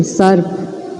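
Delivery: सर्फ drawn out slowly with a half र; slow model word. Shown as a demonstration. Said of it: "ये र जो है ये फ से पहले बोला जाएगा, आधा बोला जाएगा". (सर्फ धीरे-धीरे, आधे र के साथ)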